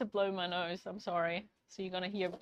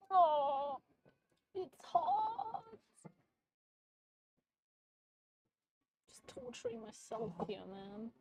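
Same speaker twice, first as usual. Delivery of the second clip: high strained voice, softer; high pained whine; 7 semitones higher, 4 dB quieter, slower than usual. Real, not acted. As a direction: whine high as the heat bites. Burning hands on hot cake.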